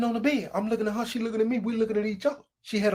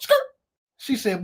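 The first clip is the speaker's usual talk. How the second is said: ordinary talk, nothing marked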